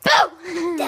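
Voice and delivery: sudden loud boo; startling boo. Real, not acted. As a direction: jump out and shout it. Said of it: "Did I scare you?".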